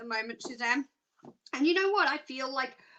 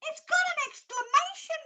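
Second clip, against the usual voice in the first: thrilled repeat, climbing higher; more excited. Real, not acted. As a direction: pitch jumps much higher on the repeat. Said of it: "I get really excited".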